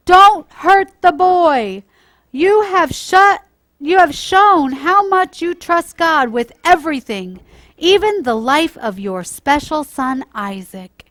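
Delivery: loud, high, slow commanding angel; angel's voice. Acted. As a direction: boom it down from heaven to stop his hand. Angel stops the sacrifice.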